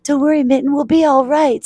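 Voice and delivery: high, animated reassurance; urgent storybook consoling; reassuring character voice. Acted. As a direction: comfort a crying friend eagerly. Sock comforts crying Mitten.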